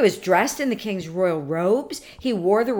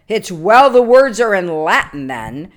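angry king; 3 semitones higher, 11 dB louder than usual. Acted. Proud king answers the clerk about the Latin, angrily. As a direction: scoff loudly with a king's offended anger. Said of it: "said the king angrily".

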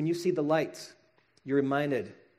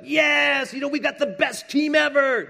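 mock hyped fan; 9 semitones higher, 5 dB louder than usual. Mocking an overhyped sports fan.

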